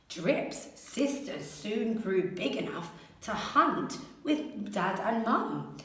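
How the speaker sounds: ordinary delivery, nothing marked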